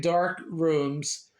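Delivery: ordinary talk, nothing marked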